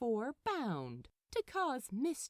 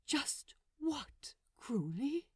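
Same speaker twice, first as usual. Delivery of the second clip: whispered, wounded question; hurt whisper; 4 semitones higher, slower than usual. Acted. Aziraphale whispering his question to Crowley. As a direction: breathe it out, stunned and hurt.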